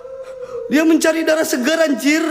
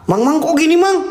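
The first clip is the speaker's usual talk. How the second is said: ordinary talk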